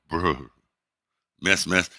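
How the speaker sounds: mildly mocking, deadpan bruh; teasing bruh. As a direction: tease him with an understated bruh. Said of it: "doing real world bruhs to my grandson".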